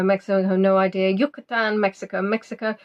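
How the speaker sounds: usual speaking voice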